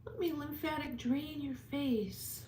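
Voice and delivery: put-on high, sweet girlfriend coo. Pretend girlfriend pampering a viewer, high and sweet. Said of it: "I'd be like".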